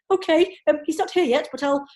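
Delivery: high, loud, rushed panicked reply; retold panic. Answering the bee lady on the phone, flustered and rushed. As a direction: gabble it in a fluster. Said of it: "it was met with panic going".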